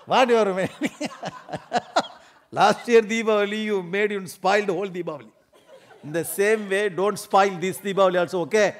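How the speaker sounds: high, loud laughing tease about last year's sweet; loud teasing reminder. Acted. Husband teasing his wife in the kitchen, loud high laughing jab. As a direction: rub it in with a grin, loud and high.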